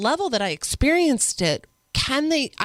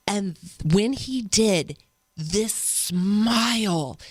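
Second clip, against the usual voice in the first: ordinary talk, nothing marked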